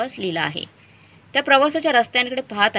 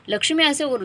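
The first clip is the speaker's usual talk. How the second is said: plain reading voice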